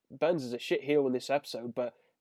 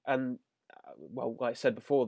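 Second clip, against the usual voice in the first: ordinary delivery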